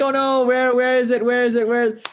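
high-pitched, understated wailing; mock crying panic. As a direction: imitate a frantic search without full screaming. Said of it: "They're screaming, they're crying".

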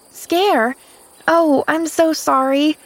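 put-on high, girlish surprise; high-pitched young girl's voice; apologetic little girl. A narrator's reading. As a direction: apologise earnestly as a child. Pollyanna answers Nancy as a child.

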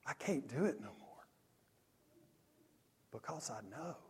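hushed, broken-off confession; under his breath. Retold or imitated. Camper admitting why he can't go on, barely audible. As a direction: drop to a soft, defeated plea. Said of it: "Under his breath, just barely audible".

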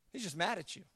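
ordinary talk

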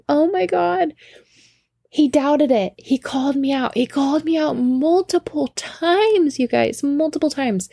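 high-pitched, incredulous gushing; gleeful disbelief. Retold or imitated. Actress in the bathroom after fooling him, gleeful disbelief. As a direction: squeal it in giddy amazement.

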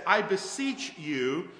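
slow, solemn I beseech you; solemn plea. Retold or imitated. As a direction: draw out the plea slowly. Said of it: "he says".

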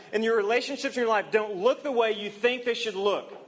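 normal talking voice

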